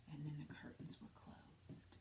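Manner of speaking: hushed suspense whisper. A narrator's reading. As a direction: drop to a secret whisper as the curtains close. Suspenseful story ending.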